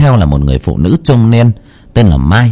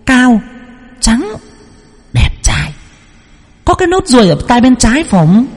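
put-on much higher, ringing child's voice; child spirit voice; 13 semitones higher than usual. Possessed medium telling Lan about her dead brother. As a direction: speak as a knowing spirit child.

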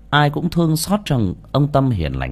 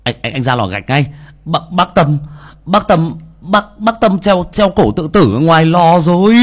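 stammering, higher and louder in panic; panicked stammering; 8 semitones higher, 8 dB louder than usual. Acted. Servant boy breaking news of the hanging to Phú, panicked stammer. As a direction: blurt out the terrible news, tripping over words.

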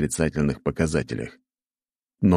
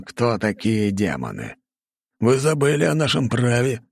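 rally the demon lords to war; fiery revolutionary speech; 4 semitones higher than usual. Acted. King's rousing war speech.

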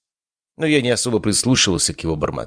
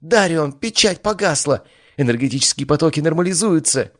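joyful shout; 9 semitones higher than usual. A narrator's reading. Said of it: "радостный вопль Арии".

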